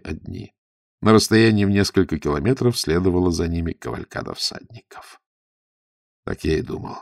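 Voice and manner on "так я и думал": gloomy. A narrator's reading. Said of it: "сказал Загорский мрачно".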